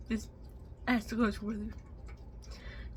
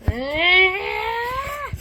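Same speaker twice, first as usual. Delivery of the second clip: frustrated high yell; 9 semitones higher, 10 dB louder than usual. Real, not acted. Frustrated cry.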